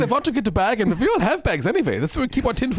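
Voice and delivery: put-on high, comic German accent. Soldiers baffled by overheard phone talk, in a mock German accent. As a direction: banter in an exaggerated German accent. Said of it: "still doing the German ac".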